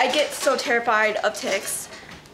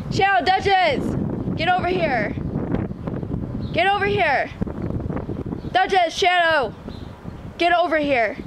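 raised, high calls across the yard; calling the dogs; 5 semitones higher than usual. Real, not acted. Owner calling her dogs back across the yard, raised and high. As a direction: call the dogs in from the grass.

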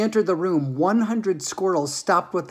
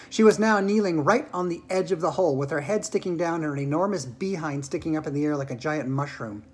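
usual speaking voice

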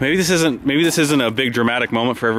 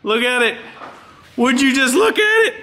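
excited; 11 semitones higher than usual. Real, not acted. Marveling at the car.

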